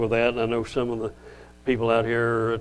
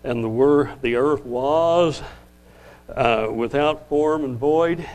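"was" stretched slow and pressed; slow down and hit "was" hard; stress on was; 2 semitones higher, slower than usual. Shown as a demonstration. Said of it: "the emphasis on the word "was".

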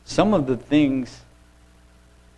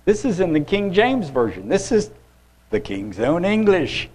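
indignant; 8 semitones higher, 4 dB louder than usual. Real, not acted. Indignant at King James wording.

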